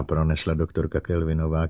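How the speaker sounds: plain reading voice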